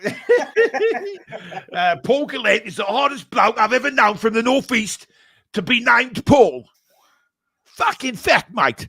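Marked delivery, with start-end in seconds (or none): 2.0-8.9 higher, louder hammed-up Cockney hard man; Danny Dyer Cockney. Co-host rereads viewer comment as Cockney hard man. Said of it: "It's like Danny Dyer saying it".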